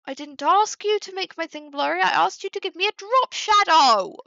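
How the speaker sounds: loud, high outraged rant; exasperated yelling. Real, not acted. Streamer yelling at the software for blurring it, exasperated. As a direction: build to a shouted complaint at the end. Ranting at Canva over blur.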